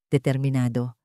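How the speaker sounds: ordinary delivery, nothing marked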